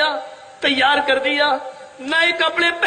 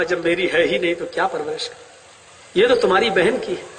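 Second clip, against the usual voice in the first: much lower, fast and curt; dismissive father; 10 semitones lower, faster than usual. Acted. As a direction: brush the idea off.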